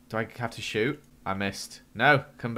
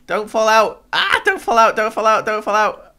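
higher and louder, frantic repeated begging; panicked pleading; 10 semitones higher, 9 dB louder than usual. Real, not acted. Player clinging on in a spinning elevator, pleading in panic. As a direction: beg yourself not to slip.

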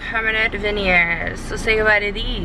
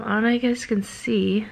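ordinary talk

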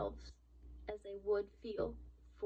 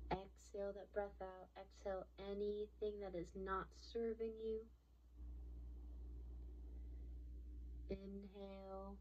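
soft, even meditation voice; soothing breathwork guide, voice gradually softening; guided meditation; 6 dB quieter than usual. Acted. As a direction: guide listeners gently into stillness, pausing between phrases.

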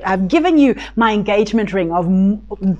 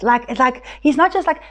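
ordinary delivery

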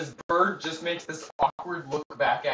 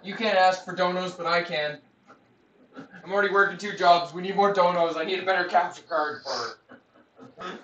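loud joking plea; 4 semitones higher, 9 dB louder than usual. Pretend. Jokingly loud begging for donations.

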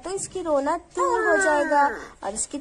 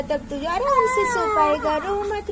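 ordinary talk

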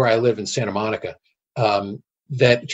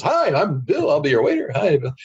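chirpy higher-pitched server greeting; cheery retold hello; very happy waiter; 4 semitones higher than usual. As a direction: imitate an over-friendly waiter introducing himself. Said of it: "very happy guy says".

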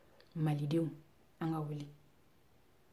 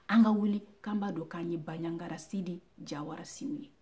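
usual speaking voice

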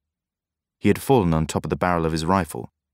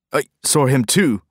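stammering; 7 semitones higher, 5 dB louder than usual. A narrator's reading. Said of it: "stammered the old fisherman".

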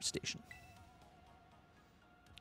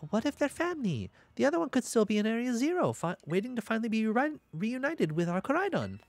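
put-on bright eager teen, pitched up high; high excited girl voice; 8 semitones higher than usual. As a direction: gush about it, pitched well up. Voicing the eager character.

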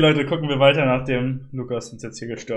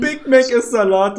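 amused; 8 semitones higher, 8 dB louder, slower than usual. Real, not acted. Repeats the caller's line laughing.

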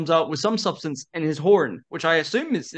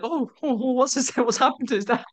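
concerned crowd; 9 semitones higher than usual. Retold or imitated. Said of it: "they're like".

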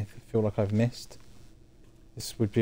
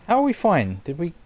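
surprised; 6 semitones higher, 4 dB louder than usual. Real, not acted. Surprised at game result.

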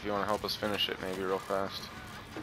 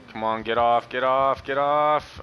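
frantic yelling; 4 semitones higher, 5 dB louder, slower than usual. Real, not acted. Frantic struggle off killer's grip.

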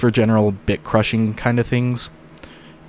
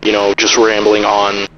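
muffled radio; 4 dB louder than usual. Shown as a demonstration. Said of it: "cut the treble again".

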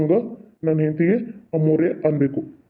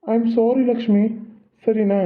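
tender apology; 6 semitones higher, slower than usual. Acted. Husband apologises to wife tenderly.

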